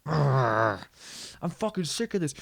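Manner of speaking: fed-up dad trying to be angry over techno; mock frustrated dad. Dad grumbling over the dance song, mock fed-up.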